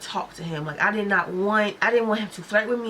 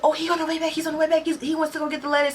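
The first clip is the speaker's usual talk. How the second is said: higher, breezy coworker voice; 7 semitones higher, faster than usual. Retold or imitated. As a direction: toss it off lightly, a bit higher. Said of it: "And she's like".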